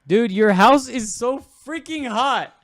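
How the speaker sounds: high, slow exasperated whine; drawn-out complaining whine; loud complaint. Real, not acted. Complaining about the heat.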